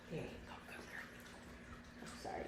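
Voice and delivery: soft whisper, barely there; whispered to the dog. Real, not acted. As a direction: hush it to the puppy beside you. Talking softly to her dog.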